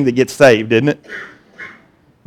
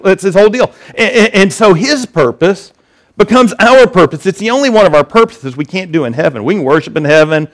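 ordinary talk, nothing marked

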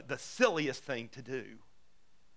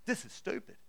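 "this is stupid" muttered unvoiced and softer; muttered under breath; 4 semitones higher, 6 dB quieter than usual. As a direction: grumble "this is stupid" to yourself, barely voiced. Grumbling listener's inner reaction.

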